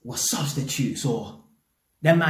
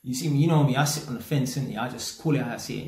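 ordinary delivery

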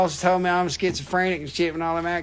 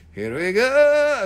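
a drawn-out, louder here we go; slow loud mock announcement; 7 semitones higher, 6 dB louder, much slower than usual.

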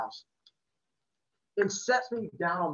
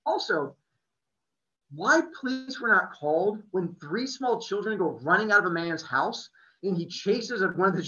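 ordinary delivery

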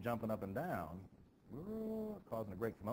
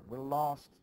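despairing cry; 5 semitones higher, 6 dB louder than usual. Acted.

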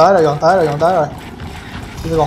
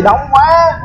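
high, alarmed cry; yelling over the gunfire; shouting orders over battle; 7 semitones higher than usual. Real, not acted. Swarmed by enemies in battle.

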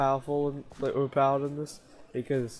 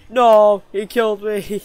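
shout; 5 semitones higher, 11 dB louder, slower than usual. Real, not acted. Killed in game, yells.

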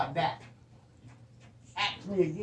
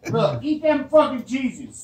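loud; 7 semitones higher, 11 dB louder, slower than usual. Real, not acted. Drunken joking, shoving food.

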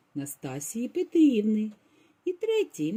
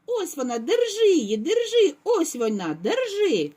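high, louder frantic cub screech; shrieking; 9 semitones higher, 4 dB louder than usual. A narrator's reading. Baby bear shrieks at the girl to catch her, high and loud. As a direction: scream like he's being cut. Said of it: "заверещав так, ніби його різали".

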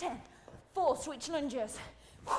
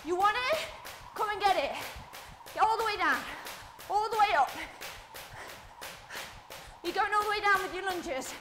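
rallying coach shout; 5 semitones higher than usual. Real, not acted. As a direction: keep driving them through the set. Coach shouting through the set.

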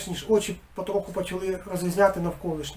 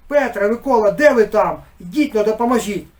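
loud call for help; 3 semitones higher, 9 dB louder than usual. A narrator's reading. Village head calling his men for help, loud shout. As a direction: shout for Petro and Mykola to come. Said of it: "не вгамовувався".

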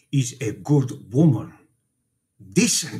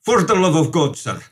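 rising to a louder, higher outburst; impassioned plea; 6 semitones higher, 4 dB louder than usual. Acted. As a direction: plead with mounting anger for the poor widow. Priest defends widow to detective.

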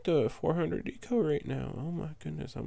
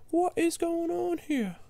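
alarmed disbelief. Real, not acted. Player watching balloons slip past his towers, high alarmed disbelief. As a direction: cry out in shocked disbelief.

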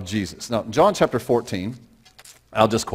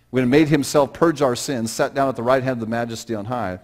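ordinary talk, nothing marked

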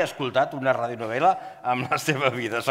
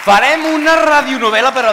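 excited; 10 semitones higher, 11 dB louder than usual. Real, not acted. Hyped announcement to audience.

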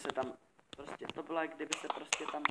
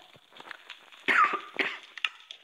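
cough; 7 dB louder than usual. Real, not acted.

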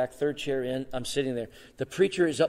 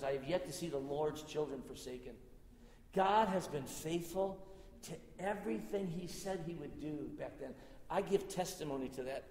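hushed testimony; 13 dB quieter than usual. Real, not acted. Pastor testifying to the congregation, reverently hushed.

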